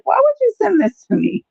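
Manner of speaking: squeaky, high-pitched disbelief; high shocked squeal. Retold or imitated. Retelling friend's inbox message, high shocked squeal. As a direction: squeal it like you can't believe your eyes. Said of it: "I was like".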